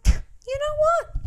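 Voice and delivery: very high, drawn-out realisation; imitating someone talked into it; mock sudden resolve. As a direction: squeak it slowly, as if an idea dawns. Voices Julia being persuaded.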